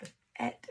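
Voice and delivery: B-et sounded softly; sounding out. Shown as a demonstration. Teacher to kids, soft sounding out. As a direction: quietly sound out B-et. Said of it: "how we're gonna spell the first part".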